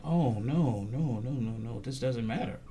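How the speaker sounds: low, soft string of mock no's; retold dawning realization. Pastor realizing his town is not safe, low and subdued. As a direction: quote his realization under the voice. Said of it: "now he's realizing".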